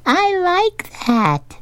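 very high, drawn-out tiny-fish voice; squeaky little old remora; Clara. A narrator's reading. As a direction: pipe it slowly, way up high. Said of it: "the remora continues".

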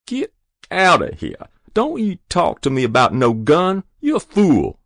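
high, loud dismissal; angry scolding. A narrator's reading. Mother refusing gun talk, angry scolding. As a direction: tell him off sharply.

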